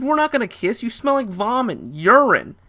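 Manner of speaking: high, loud disgusted refusal. Retold or imitated. Narrator rejecting the drunk woman, high and disgusted. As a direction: push her off in disgust.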